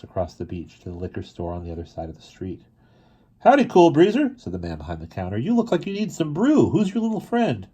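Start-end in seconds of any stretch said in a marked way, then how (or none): 3.4-4.4 high, loud friendly greeting; chirpy counterman calls out howdy; cheery shopkeeper. Acted. Shopkeeper greets Cool Breezer. 5.4-7.7 high, chummy counterman; a hint of a jovial barkeep; high friendly shop clerk; lightly voiced shopkeeper. Shopkeeper talks to Cool Breezer.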